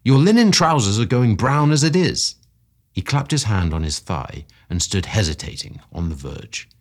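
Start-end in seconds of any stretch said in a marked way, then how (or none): 0.0-2.4 high, loud warning; cried out. A narrator's reading. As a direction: call out a warning to a running man. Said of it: "I cried".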